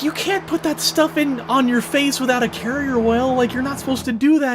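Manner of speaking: high-pitched, put-on scolding voice; imitated scolding woman. Woman scolding a man over carrier oil, imitated scolding.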